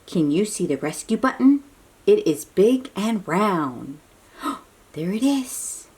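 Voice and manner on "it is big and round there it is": ordinary delivery, nothing marked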